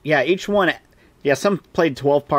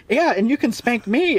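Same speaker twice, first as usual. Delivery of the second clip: much higher mock girlish voice; eager maiden; 10 semitones higher than usual. Castle maiden offering herself to Galahad, mock high and eager. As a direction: pipe up eagerly like a giggly maiden. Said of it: "another girl's like".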